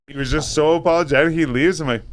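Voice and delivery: ordinary talk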